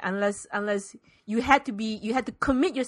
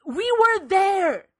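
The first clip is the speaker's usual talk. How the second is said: insistent retort; 10 semitones higher, 7 dB louder, much slower than usual. Retold or imitated. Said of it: "I kept trying to say".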